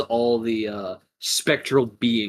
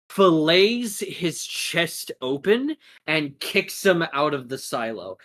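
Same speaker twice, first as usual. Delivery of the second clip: ordinary talk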